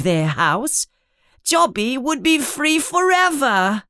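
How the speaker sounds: high, squeaky elf voice, sad and pleading; pleading house elf. Acted. Dobby the house elf pleads with Harry, high and squeaky. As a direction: plead with him like a worried little elf.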